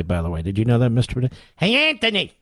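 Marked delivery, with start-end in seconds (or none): 1.6-2.3 much higher South Philly holler across the street; South Philly neighbor shouting for Anthony; South Philly calling out. Retold or imitated. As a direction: call him in from across the block, South Philly style. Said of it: "the way they say it in South Philly".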